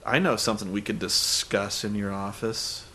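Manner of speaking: low, soft mock-seductive voice; suggestive come-on. Host mimicking her proposition to the boss, mock sultry. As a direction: purr it as an exaggerated innuendo.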